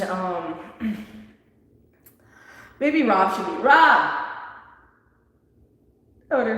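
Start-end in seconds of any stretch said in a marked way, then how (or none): 3.6-4.2 louder one-word summons; calling out. Real, not acted. Host calling for someone who may be busy helping, raised voice. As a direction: call someone in from elsewhere. Calls out to Rob off mic.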